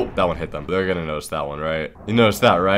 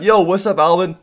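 friendly greeting; 10 semitones higher, 4 dB louder than usual. Pretend. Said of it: "be like".

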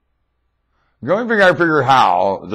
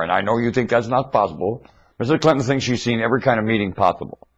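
usual speaking voice

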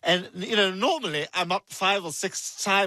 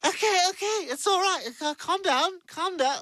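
put-on high-pitched, drunken placating; high, fussy drunken protest; tipsy protest; 12 semitones higher than usual. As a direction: fend someone off in a high voice. Drunk character told to come along.

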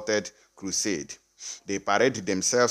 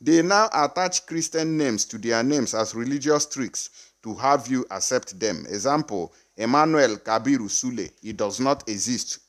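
ordinary talk

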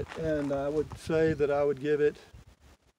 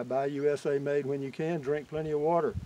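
ordinary talk

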